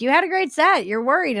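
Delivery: a hint of the older comic teasing; quoting Colin Quinn. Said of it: "he's like".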